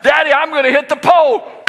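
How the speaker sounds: put-on high, loud child's wail; panicked scream. Daughter crying out to dad before crash, panicked scream. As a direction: shriek it right before impact.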